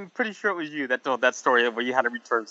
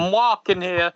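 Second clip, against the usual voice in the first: put-on New York accent; 7 semitones higher, slower than usual. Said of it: "everybody talks like that".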